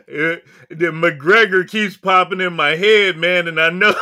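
loud, high, laughing through the words; laughing while talking. Real, not acted. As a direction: giggle the words out as you go. Host laughing at his name mix-up.

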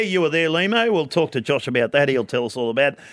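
usual speaking voice

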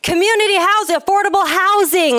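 high, sing-song mock of the mayor's slogan. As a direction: parrot the buzzwords back with scorn. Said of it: "And you're standing outside the building saying".

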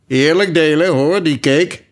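loud, high ordering voice; commanding lion. A narrator's reading. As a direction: lay down the rule loudly. Lion orders fair sharing.

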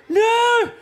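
very high, loud wail; horrified no. Retold or imitated. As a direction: cry out in dread. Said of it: "looking at him, and like".

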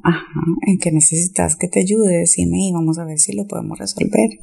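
usual speaking voice

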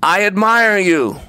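high, slow calling-out; father's yell. A narrator's reading. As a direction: call it long across a valley. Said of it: "his father yelled to the mountain".

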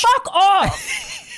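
high laughing yell back; shouted retort. Retold or imitated. Kid snapping back at parents.

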